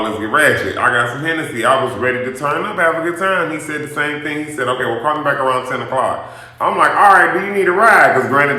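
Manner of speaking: ordinary talk, nothing marked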